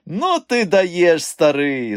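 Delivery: high, slow, loud gloating. A narrator's reading. Mikha to his friend after laughing at him, gloating. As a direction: drag it out high, gloating over the old pal. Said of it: "зловтішався він".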